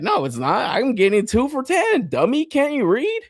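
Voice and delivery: high-pitched, whiny, condescending; mock smug shopper. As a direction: sneer like a know-it-all fool. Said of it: "He's like".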